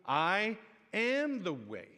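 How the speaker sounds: slow, drawn-out emphatic declaration; slow preaching emphasis. Acted. Pastor proclaiming Jesus' words slowly to the congregation. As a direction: let each word land slowly.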